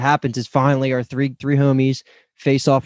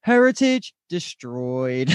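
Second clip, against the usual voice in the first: very slow, drawn-out announcer delivery; mock announcement; much slower than usual. Monument announcing it has been cut, mock announcement. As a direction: stretch each word like an automated verdict.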